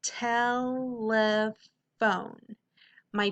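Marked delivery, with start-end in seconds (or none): 0.0-1.3 telephone very slowly, as tel, le, phone; drawn-out, level-pitched telephone in three beats; enunciated by syllable. Shown as a demonstration. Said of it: "enunciate the word as you say it".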